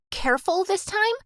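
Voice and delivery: high mocking echo. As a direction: throw her words back scornfully. Anna mocking the apology.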